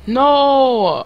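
loud, high cry of protest; outraged shout. Real, not acted. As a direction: yell it in sudden disbelief. Protesting a play going wrong.

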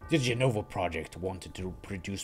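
low, cold villain narration; calm menacing villain lecture; Sephiroth voice. Acted. Voices Sephiroth continuing story.

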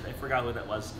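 usual speaking voice

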